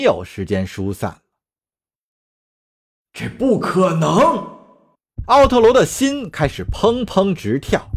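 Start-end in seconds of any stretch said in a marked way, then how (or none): 3.1-4.6 slow, drawn-out denial; stunned disbelief. Acted. Otero watches the ship approach, stunned disbelief. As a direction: stretch the words in shock. Horror as ship heads at station.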